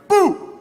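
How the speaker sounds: mock scary boo. Preacher spooking the crowd with a loud boo.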